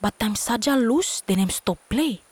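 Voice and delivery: ordinary delivery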